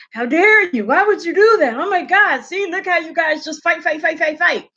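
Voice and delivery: high-pitched, scandalized imitation; mock outrage. Vlogger mimicking people scolding critics, mock outrage. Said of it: "to sit there and say".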